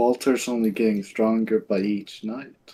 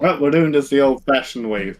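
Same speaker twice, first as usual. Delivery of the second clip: normal talking voice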